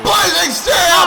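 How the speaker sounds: loud, high, drawn-out move call; excited shout. Real, not acted. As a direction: belt out the move name. Move called live.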